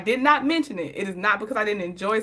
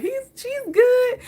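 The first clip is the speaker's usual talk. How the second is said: high quoted reply; 13 semitones higher, slower than usual. Retold or imitated. Friend quoted, high and slow.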